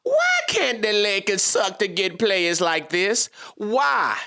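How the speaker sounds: slow, drawn-out comic complaint in a put-on voice; mock-outraged comedian impression, dragged out slowly; Cat Williams voice. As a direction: stretch every word like a stand-up bit, pleading to the heavens. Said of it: "in my Cat Williams voice".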